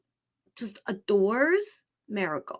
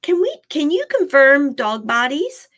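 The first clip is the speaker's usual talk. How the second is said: ordinary delivery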